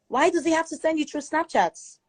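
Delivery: usual speaking voice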